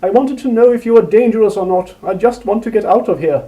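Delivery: high, loud pained cry. A narrator's reading. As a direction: cry it out through tears of pain. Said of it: "tears of pain and fear flowing".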